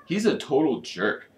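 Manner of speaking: ordinary delivery, nothing marked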